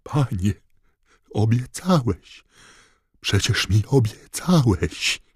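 high, slow desperate wail; tearful pleading. Acted. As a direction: beg through tears. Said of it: "wycharczał i zakrztusił się łzami".

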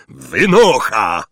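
loud, drawn-out furious order; roar. A narrator's reading. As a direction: roar them out of the room. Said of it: "ryknął wuj Vernon". (głośny, gniewny ryk)

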